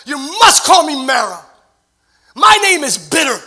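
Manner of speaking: loud, high, bitter protest; a woman refusing her old name, shouting; bitter outburst. Retold or imitated. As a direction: shout it out in hurt and anger. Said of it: "she makes the following comment".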